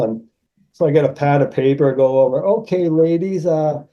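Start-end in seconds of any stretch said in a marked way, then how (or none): 2.4-3.8 higher-pitched waiter's greeting; lightly put-on server voice; playful waiter. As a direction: act the waiter taking an order. Poses as waiter at table.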